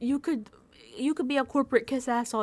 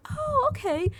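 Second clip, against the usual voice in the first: meek Mel; 13 semitones higher, slower than usual. Retold or imitated. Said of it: "Mel's like".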